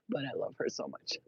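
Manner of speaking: soft voiceless whisper of love; whispered tenderness. Real, not acted. Confessing love for her annoying cat, whispered. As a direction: breathe it like a secret.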